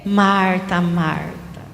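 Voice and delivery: low, slow, drawn-out calling of a name; gentle solemn intonation. Acted. Jesus answering the complaining Marta, gently solemn. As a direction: call the name softly and low, stretched out. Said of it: "a gente fala nessa entonação".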